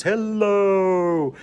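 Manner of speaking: high, drawn-out silly hello; goofy sing-song greeting; Seinfeld "the voice". Retold or imitated. Teacher showing the class the goofy voice. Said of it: "do that stupid voice".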